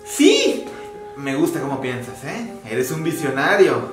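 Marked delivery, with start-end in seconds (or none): 0.0-0.6 excited yes. Acted. Excited agreement.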